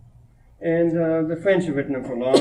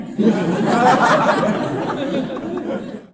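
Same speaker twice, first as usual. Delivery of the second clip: high amused laugh; 8 semitones higher than usual. Real, not acted. Laughs at poo-poo story.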